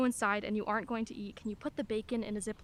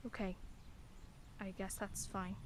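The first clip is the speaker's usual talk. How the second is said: much softer and lower, deflated; hurt resignation; 3 semitones lower, 13 dB quieter than usual. Acted. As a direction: give in quietly, hurt. Rebuffed mother gives in.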